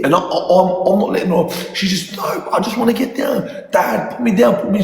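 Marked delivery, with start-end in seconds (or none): 2.2-4.8 daughter going berserk. Retold or imitated. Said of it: "she's going berserk".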